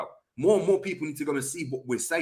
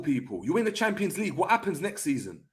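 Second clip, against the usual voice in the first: usual speaking voice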